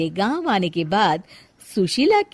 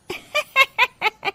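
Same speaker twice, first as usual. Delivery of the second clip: high cackling laugh of gossiping women. Acted. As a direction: burst into a mocking laugh. Village women mock Sushila.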